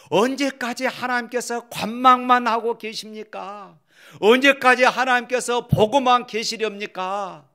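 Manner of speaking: high, loud appeal to God; pleading complaint. Acted. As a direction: cry out the question, pitch held high. Voicing the psalmist's complaint to God.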